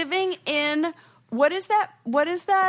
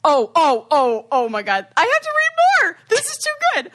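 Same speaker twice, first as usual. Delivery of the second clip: excited; 4 semitones higher, 5 dB louder than usual. Real, not acted. Excited about reading more.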